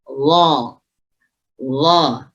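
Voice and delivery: "Allah" said as a thick, rounded "zhaw"; zha tebal. Shown as a demonstration. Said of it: "ditebalkan saja". (bunyi "zho" tebal dan bulat)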